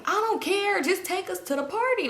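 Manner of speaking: high, insistent plea; insistent teen demand. Retold or imitated. Daughter insisting dad drive them, high-pitched demand.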